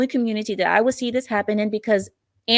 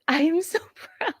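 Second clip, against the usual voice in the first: laughing with pride; 5 semitones higher than usual. Real, not acted. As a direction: let a laugh cut into the words. Laughs while praising the man.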